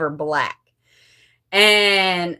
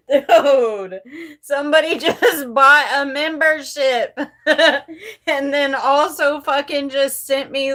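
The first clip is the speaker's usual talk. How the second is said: real tearful laughter, a little higher; laughing and crying; 3 semitones higher than usual. Real, not acted. Vlogger thanking a new supporter, laughing and crying. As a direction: let the laughs and tears break in. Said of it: "she's laughing and crying".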